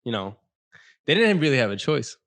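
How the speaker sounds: ordinary talk, nothing marked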